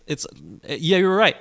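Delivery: ordinary delivery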